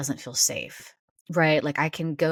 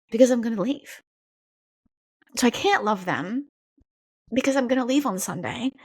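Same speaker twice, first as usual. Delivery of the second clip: higher, near tears; pushing words through welling tears; close to tears; 7 semitones higher than usual. Real, not acted. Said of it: "Now I'm gonna cry".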